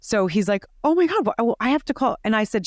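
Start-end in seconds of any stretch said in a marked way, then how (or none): 0.8-2.2 high, rattled and scrambling; flustered principal. Retold or imitated. Principal panicking about calling the mother, higher and flustered. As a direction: sputter like a principal caught off guard.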